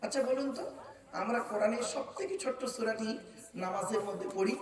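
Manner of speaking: normal talking voice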